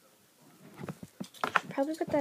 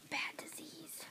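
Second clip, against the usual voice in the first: whispering; 5 semitones higher, 9 dB quieter, slower than usual. Real, not acted. Whispered gossip.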